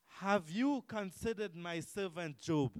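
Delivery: loud, slow proclamation in God's role; God calling out. Acted. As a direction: call it out slowly and forcefully, as the Lord. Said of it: "God was the one who called out".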